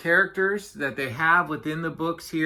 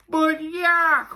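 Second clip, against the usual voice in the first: high, stretched-out wail; 13 semitones higher, 5 dB louder than usual. A narrator's reading. Walrus failing to interrupt Yak, wailing. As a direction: wail the name helplessly. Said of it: "wailed Walrus".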